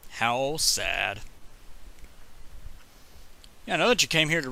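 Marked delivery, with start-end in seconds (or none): 0.1-1.2 low, deadpan little lament; understated mock pity, pitched down; mock sad. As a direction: feign sorrow over a tiny misfortune. Mock lament over empty soda.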